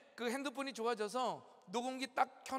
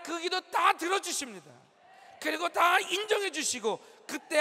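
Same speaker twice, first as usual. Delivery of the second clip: preaching at full force; 4 semitones higher, 8 dB louder than usual. Acted. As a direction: shout the promise to the congregation. Closing altar call, shouted.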